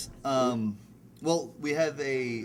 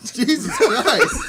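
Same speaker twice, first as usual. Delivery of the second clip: laughing, higher, louder, slow; 8 semitones higher, 11 dB louder, much slower than usual. Real, not acted. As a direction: burst out laughing in disbelief. Shocked at a crude joke.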